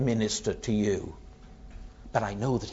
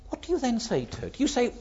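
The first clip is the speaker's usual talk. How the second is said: usual speaking voice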